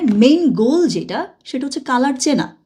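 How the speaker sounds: normal talking voice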